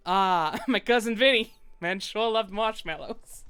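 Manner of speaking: loud New York alligator reminiscing; New York accent. Acted. Alligator reminisces about cousin Vinny in New York accent. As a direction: lean into the New York twang. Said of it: "The New York voice".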